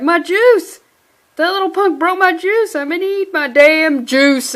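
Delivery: put-on loud, high, childish outrage over juice; outraged yell. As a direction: yell it like a villain who lost his snack. Villain rages over broken juice.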